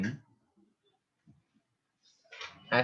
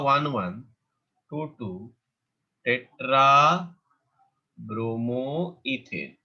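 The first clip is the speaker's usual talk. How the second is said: slow dictation; much slower than usual. Shown as a demonstration. Teacher dictating name to students.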